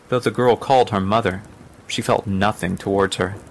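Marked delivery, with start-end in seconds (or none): none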